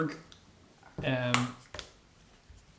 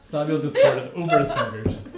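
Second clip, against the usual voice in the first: laughing; 2 semitones higher, 9 dB louder than usual. Real, not acted. Laughing as child spills milk.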